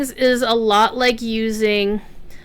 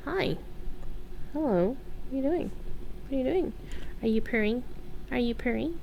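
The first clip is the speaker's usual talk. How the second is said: quiet, tender words to a cat; soft pet talk; 3 semitones higher, 11 dB quieter, faster than usual. Real, not acted. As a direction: murmur lovingly to a purring cat. Talking softly to pet cat.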